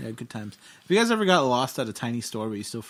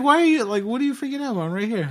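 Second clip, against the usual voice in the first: higher mock mom voice, easing down; mom's retold reassurance; 9 semitones higher than usual. Mom to her lost son, mock exasperated and high. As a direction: imitate an exasperated mom, pitched up.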